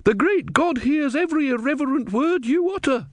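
high-pitched righteous indignation; heated. A narrator's reading. Devout woman warning the novice about irreverence, heated. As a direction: scold as an outraged pious woman, pitched far up. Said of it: "said the woman hotly".

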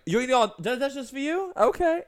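high, loud, judgy waiter voice; a sassy diner waiter mocking the order. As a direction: sneer at the order like a rude server. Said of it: "and they're like".

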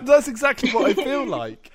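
laughing through the words. Real, not acted. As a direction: laugh it out while agreeing.

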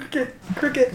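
giggly high mock crickets; laughing cricket noise. Real, not acted. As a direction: mimic the dead silence while laughing. Mocking the silence.

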